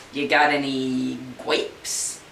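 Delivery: slow, drawn-out cartoon duck. Duck asking the clerk for grapes, slow silly voice. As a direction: ask it like a dopey duck, slowly.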